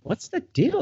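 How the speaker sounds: high, puzzled mock question; wife at the party finding something in the cake, high and puzzled. High baffled voice.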